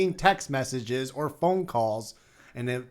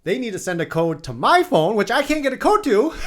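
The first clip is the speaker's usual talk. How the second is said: loud, high-pitched protest; exasperated rant; 10 semitones higher, 9 dB louder than usual. Real, not acted. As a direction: build to an incredulous yell. Exasperated rant about the code.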